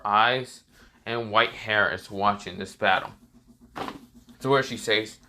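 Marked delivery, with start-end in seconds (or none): none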